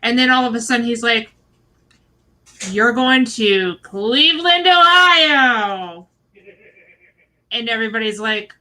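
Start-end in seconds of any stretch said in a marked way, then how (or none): 2.7-6.1 drawn-out, rising announcer reveal; big announcement. Retold or imitated. Retelling host's big announcement.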